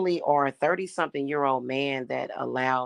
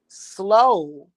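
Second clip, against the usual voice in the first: higher, louder contempt; heated insult; 6 semitones higher, 6 dB louder, much slower than usual. Real, not acted. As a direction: spit out the insults, raised voice.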